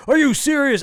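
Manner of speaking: high, loud acted disbelief; mock amazement, pitched right up; incredulous guard. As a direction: ask it like a stunned movie guard.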